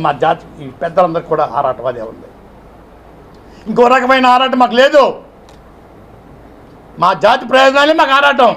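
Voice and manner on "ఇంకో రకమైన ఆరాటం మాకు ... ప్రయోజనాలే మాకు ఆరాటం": high and loud insistence; passionate declaration. Real, not acted. Leader telling Jagan his only passion is his community, loudly. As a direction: declare it with full conviction.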